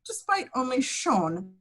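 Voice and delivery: ordinary talk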